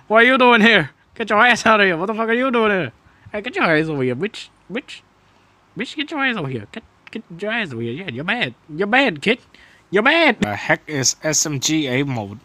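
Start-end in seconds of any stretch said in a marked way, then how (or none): 0.1-10.4 high-pitched trash talk; taunting. Real, not acted. Gamer trash-talking an enemy player in the match, taunting. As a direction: scold and heckle in a raised, squealing pitch.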